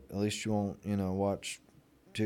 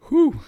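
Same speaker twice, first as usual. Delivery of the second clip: high loud whew; 13 dB louder than usual. Real, not acted. Calming himself down.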